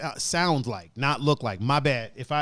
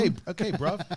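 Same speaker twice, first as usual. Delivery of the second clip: higher-pitched fake British voice; mock British accent; 3 semitones higher than usual. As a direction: echo it as a pretend Brit. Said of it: "a Southern and British accent".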